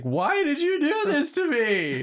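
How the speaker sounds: high, slow whiny plea. Acted. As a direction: whine it like a cartoon victim. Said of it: "this guy's just like".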